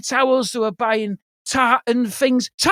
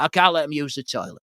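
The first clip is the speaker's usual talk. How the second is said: mock grumpy driver; 9 semitones lower, 4 dB quieter than usual. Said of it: "he was like".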